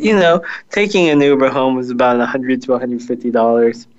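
ordinary delivery

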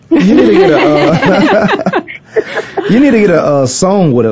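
giggling through the words; can't stop laughing. Real, not acted.

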